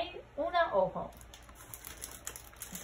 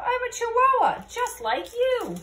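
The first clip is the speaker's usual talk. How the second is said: much higher and louder, slowing at the end; high declaration; 11 semitones higher, 7 dB louder than usual. Acted. Scapito proudly declaring what he is. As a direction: squeak it out proudly.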